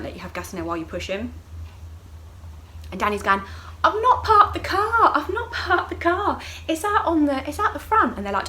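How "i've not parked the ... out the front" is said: high, loud, slow mock wail; fretting partner. Danny to staff about car out front, high fretful wail. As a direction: imitate a worried partner wailing.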